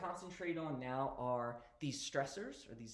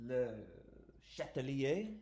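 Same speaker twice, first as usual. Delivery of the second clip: self-mocking attempt at a French accent, a little louder; bad French accent; 3 dB louder than usual. Shown as a demonstration. Teacher to students naming the principle, in a mock French accent. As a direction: say Le Chatelier in a hammed-up French accent. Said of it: "It's my best, worst French accent".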